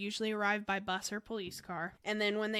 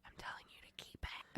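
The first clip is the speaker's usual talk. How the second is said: hushed whisper; 15 dB quieter than usual. Real, not acted. Whispered aside.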